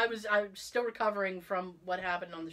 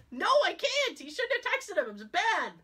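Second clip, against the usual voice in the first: pouty insistence; 10 semitones higher, 3 dB louder than usual. Retold or imitated. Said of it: "answers husband".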